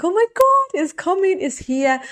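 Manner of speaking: thrilled as the ship arrives, rising glee; excited squeal. Pretend. Imagining the ship arriving with her desire, excited squeal. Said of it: "get really excited about your desire coming".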